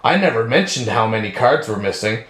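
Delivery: low, measured detective line; deadpan kid detective. Retold or imitated. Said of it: "he's just like".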